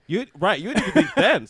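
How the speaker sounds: high, loud, through laughter; laughing protest. Real, not acted. Teasing the friend who gave him a lift, laughing. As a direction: crack up while protesting. Laughing as he teases his bandmate.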